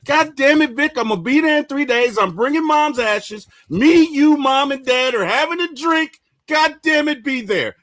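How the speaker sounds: high, loud, insistent phone yell; acting out an excited demand; imagined rallying shout. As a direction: order your brother to be there, full voice. Imagined insistent call to brother.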